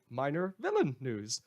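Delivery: normal talking voice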